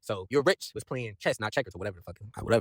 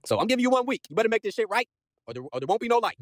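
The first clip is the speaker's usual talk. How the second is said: higher-pitched mock ultimatum; spazzing out; 9 semitones higher than usual. Recapper as Osborn threatening his men with a deadline, higher worked-up voice. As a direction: lay down the threat in a raised pitch. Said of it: "Then Osborn was spazzing out".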